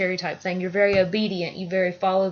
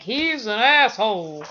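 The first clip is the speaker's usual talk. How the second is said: slow, drawn-out mock griping; retold complaint; 4 dB louder, slower than usual. People griping about his tyrant father, slow retold complaint. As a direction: stretch it out like people passing judgment.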